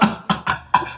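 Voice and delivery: soft, breathy string of chuckles; real chuckle. Real, not acted. Storyteller amused by his friend's bad luck, soft chuckle. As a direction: chuckle quietly at your own joke. Laughs at his own joke.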